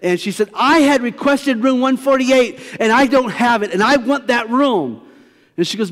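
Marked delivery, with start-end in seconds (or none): 0.5-5.0 demanding hotel guest. Retold or imitated. Irate hotel guest demanding room.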